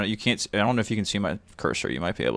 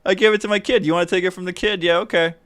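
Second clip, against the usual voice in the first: high, loud, panicky mock dad voice, much higher; 11 semitones higher, 6 dB louder than usual. Mimicking the flustered dad handing over the ball, high mock panic. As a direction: squeak out the dad's excuses in a frantic, pitched-up parody. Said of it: "he's like".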